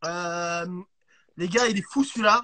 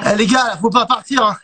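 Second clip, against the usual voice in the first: calling out loud; 10 dB louder than usual. Real, not acted. Calling out to viewers.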